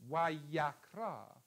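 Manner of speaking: wayakra spelled out slowly, syllable by syllable; slow Hebrew word. Shown as a demonstration. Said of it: "La vocale in questa sillaba è la pathak". (wayakra scandito lentamente)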